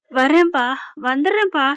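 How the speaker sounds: usual speaking voice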